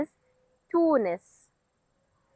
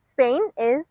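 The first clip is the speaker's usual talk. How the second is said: normal talking voice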